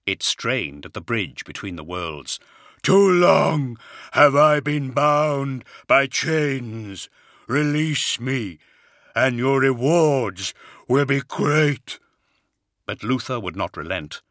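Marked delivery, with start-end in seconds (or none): 2.8-12.0 slow, raised, otherworldly creature voice; booming, drawn-out monster plea; thundering monster. A narrator's reading. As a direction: drag out each word as a vast chained demon. The bound being speaks again.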